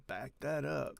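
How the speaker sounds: usual speaking voice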